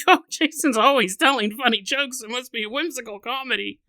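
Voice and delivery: a hint of a naive fan; naive listener voice. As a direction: play the fan who expects jokes. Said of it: "if you're like".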